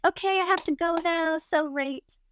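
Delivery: high, breezy mock-innocent excuse; dumb and innocent. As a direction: play clueless while making a polite exit. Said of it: "dumb, innocent".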